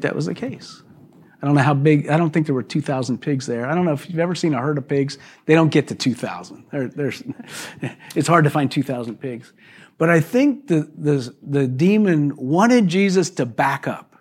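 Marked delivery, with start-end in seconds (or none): none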